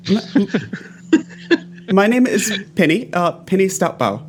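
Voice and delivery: halting, lightly voiced nervous messenger; nervous herder messenger. Herder introducing herself to armed strangers, lightly voiced and hesitant. Game master plays Penny the herder.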